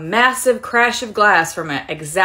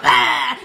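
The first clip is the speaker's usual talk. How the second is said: loud, higher comic "ah"; mock startled yell; 5 semitones higher, 5 dB louder than usual. Customer rounding the corner, mock yell. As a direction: yelp as if caught off guard. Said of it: "they come around the corner like".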